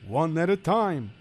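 put-on worried Yiddish-accented kvetching. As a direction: complain like a hapless schlemiel. Figure explains.

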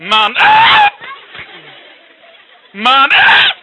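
high strained yell; loud outcry. Acted. Story character crying out in a high yell. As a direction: cry out sharply.

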